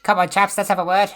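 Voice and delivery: eloquent goblin speaker. Acted. Krill invites the goblins over, loud and eloquent. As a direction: call to them with chummy eloquence. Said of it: "Krill speaks Goblin very, very well, very eloquently".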